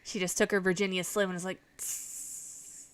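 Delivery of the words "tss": unvoiced tss of a stubbed cigarette; cigarette hiss. Grandma putting out cigarette.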